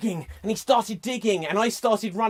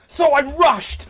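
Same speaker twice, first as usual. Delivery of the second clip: loud, drawn-out action-hero line; hammed-up chase narration; urgent action; 6 semitones higher, 8 dB louder than usual. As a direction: push it out with urgency.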